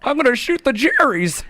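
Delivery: high-pitched old-Hollywood star impression; Jimmy Stewart. Friend joining the war bit, Jimmy Stewart impression. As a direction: join the bit in a high mock-movie-star voice. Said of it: "the James Stewart voice".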